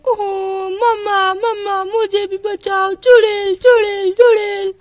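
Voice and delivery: put-on a scared little boy crying, then screaming witch; crying child. Chintu wakes crying for mummy.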